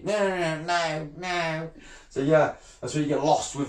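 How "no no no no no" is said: higher, put-on whinging voice; mocking refusal. Fisherman mimicking trolls who won't meet him, mocking whinge. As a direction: mimic a troll wriggling out with a string of noes.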